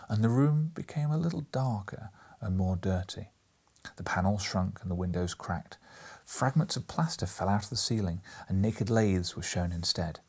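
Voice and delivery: ordinary delivery, nothing marked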